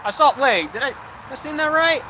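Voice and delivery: sudden high-pitched surprise; shocked, voice jumping up; startled disbelief. Real, not acted. Sees a shooting star.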